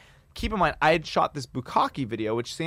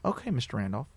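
softer, subdued reply; gone quiet; 2 semitones lower, 8 dB quieter than usual. Retold or imitated. Comedian answers caller after a silence, quietly. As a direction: imitate a man quietly relenting. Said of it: "he just gets quiet and he's like".

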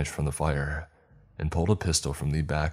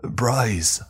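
loud, higher call of a name; shout; 7 semitones higher, 5 dB louder than usual. Acted. Narrator shouting to the lost boy, loud. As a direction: call into the dark. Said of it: "I shouted".

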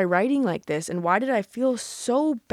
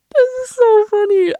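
squealing through laughter, much higher and louder; laughing; 11 dB louder than usual. Real, not acted. Laughing at old diary entry.

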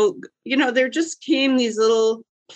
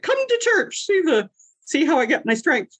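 much higher, eager call to church; enthusiastic invite; 9 semitones higher than usual. Retold or imitated. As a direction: invite the crew with bright enthusiasm. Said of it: "I said".

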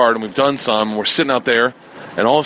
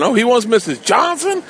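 high, teasing scoff; mock him for what he asked for; lightly voiced, jeering dealer; mocking disbelief; 12 semitones higher than usual. Drug dealers laughing at him.

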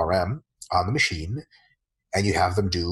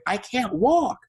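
much higher, put-on sore client voice; mock whiny client; 12 semitones higher, much slower than usual. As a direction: whine like a sore newbie.

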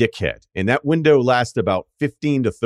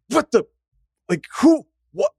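stunned confusion. Retold or imitated. Scott's stunned reaction retold.

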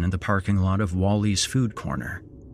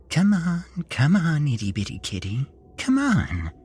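high, shy, timid sing-song; 7 semitones higher than usual. A narrator's reading. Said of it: "said in a sing-song voice".

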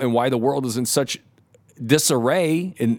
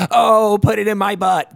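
higher, loud hammed-up moan; mock shout; 8 semitones higher, 6 dB louder than usual. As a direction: yell it like letting loose. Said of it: "we're in a hotel room".

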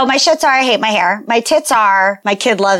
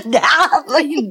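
toddler imitation; 4 semitones higher than usual. Copying the imitated toddler call.

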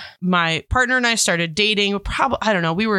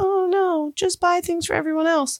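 put-on selfless little girl; 10 semitones higher than usual. Her younger self declining gifts.

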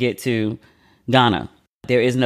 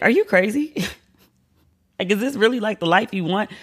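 incredulous self question; 8 semitones higher than usual. Retold or imitated. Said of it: "I ask myself every day".

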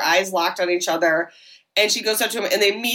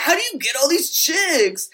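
guy voice; 7 semitones higher than usual. Retold or imitated. Retold guy asking Jake.

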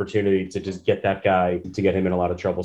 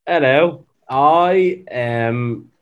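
louder, slow mock British accent; 4 semitones higher, 6 dB louder, much slower than usual. Host greeting the podcast in a mock British accent. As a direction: announce it like a lord in British accent. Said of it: "a little bit of [name]".

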